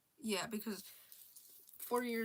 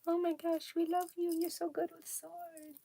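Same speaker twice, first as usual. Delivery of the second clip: high, gushing fan-club voice; mock adoring crowd, pitched up; fawning legionaries; 8 semitones higher than usual. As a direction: gush over him like adoring fans. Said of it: "everyone in the Legion's like".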